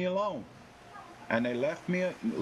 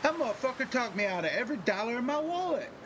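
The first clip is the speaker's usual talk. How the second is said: much higher, fast, frantic mock outrage; robbed mark; 10 semitones higher, faster than usual. Cheated carnival customer realizing his wallet is empty, high frantic. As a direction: panic as you check your empty wallet.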